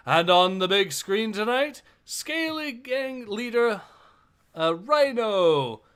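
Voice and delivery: lightly voiced arena announcer. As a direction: present the fighters like a show host. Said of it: "I'll do the voice of the announcer here".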